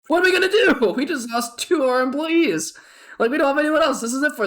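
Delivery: loud, very high mock panic; panicked. Worker left short-staffed after two walkouts, hammed-up panic. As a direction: act out a freaked-out worker, voice shooting up.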